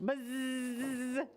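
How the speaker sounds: long sustained buzzing; bee buzz. Acted. Mad bee answering the yelling boy, long buzz.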